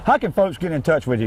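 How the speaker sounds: normal talking voice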